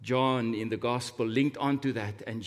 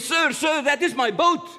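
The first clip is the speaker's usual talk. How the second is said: put-on much higher, louder, a boy bursting with excitement; excited little boy; 12 semitones higher, 6 dB louder than usual. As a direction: call out to the shopkeeper, thrilled. Boy sees his boat in window.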